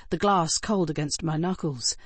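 usual speaking voice

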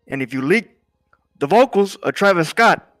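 loud, high warning; intense. Acted. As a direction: warn him forcefully. Boss warning about another leak.

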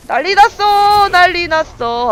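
high, slow, stretched-out cry of chaos; worked-up exclaiming. Real, not acted. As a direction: draw it out loudly, sliding down. Chaos in the game battle.

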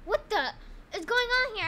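startled high yelp; shocked cry, voice jumping up; scared horror game streamer. Real, not acted.

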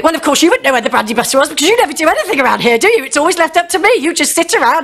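high, fast hammed-up nagging; mock nagging rant. Wife nagging her husband about the brandy butter, fast high rant. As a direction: rattle off the complaint like a fed-up wife. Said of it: "And I'll go".

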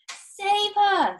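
high, loud cry for help; sudden high-pitched plea; frantic scream. A narrator's reading. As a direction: scream it like a desperate mother. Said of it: "screamed Missus Salt".

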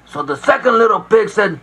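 usual speaking voice

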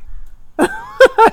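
a loud, high, delighted laugh; high burst of laughter. Real, not acted. Medium cracking up at George's telepathy television joke, laughing high.

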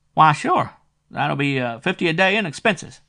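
a small-time dick squeaking out his price; shrill private eye. A narrator's reading. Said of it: "This dick had a shrill voice".

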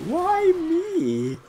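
very high, drawn-out whine; plaintive wail. Real, not acted. Player picked on by the boomer, high plaintive wail. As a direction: bemoan your bad luck. Lamenting bad luck.

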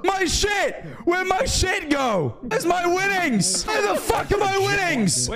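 high, slow anguished yells; high drawn-out shouts of despair; distraught shouting. Real, not acted. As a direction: wail over the lost winnings.

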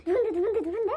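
high, loud nosy pestering; eager friends. Retold or imitated. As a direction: beg to know who. Friends eagerly asking who. (궁금해서 들뜬 친구들 목소리)

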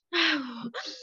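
slow audible breath in and out; calming breath demo; demonstrated deep breath. Shown as a demonstration. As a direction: show the child how to breathe. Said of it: "toma aire y bota aire".

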